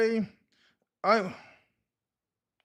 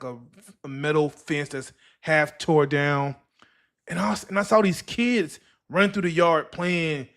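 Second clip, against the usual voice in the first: ordinary delivery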